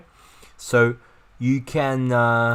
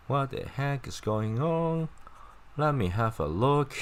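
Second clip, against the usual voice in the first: puzzled onlooker; 7 semitones higher than usual. Acted. Voicing confused person in illustration.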